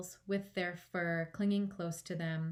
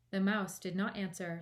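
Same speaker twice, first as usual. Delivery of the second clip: usual speaking voice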